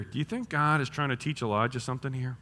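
ordinary talk

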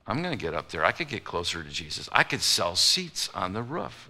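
soft, sly plotting under the breath; a quiet opportunist hatching a plan; scheming aside. Retold or imitated. Pastor voicing opportunists plotting roof seats, sly and soft. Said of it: "the opportunists in the crowd are going".

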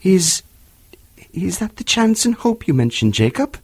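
hesitant, halting question; a frightened man stammering; faltering voice. A narrator's reading. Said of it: "in a faltering voice".

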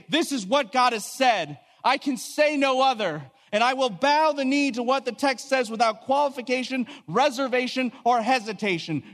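high-pitched, emphatic vow; resolute declaration. Acted. Preacher voicing believers who surrender to the text, resolute declaration. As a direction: proclaim it with ringing conviction. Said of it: "and we say".

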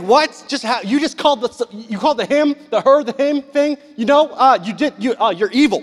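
high, loud stammering mock outrage; stunned disbelief. Stunned onlooker calling out a churchgoer's act, sputtering disbelief. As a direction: sputter accusingly, tripping over the words.

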